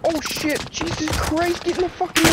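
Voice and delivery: high, frantic panicked cursing; swearing in a frantic panic. Real, not acted. Panicked under fire.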